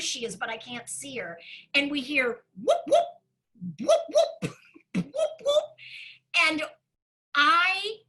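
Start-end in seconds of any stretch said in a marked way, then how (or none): 2.6-5.6 mock police siren whoops, rising high; imitated siren whoop. Hearing the police car in the storm, a mock siren whoop.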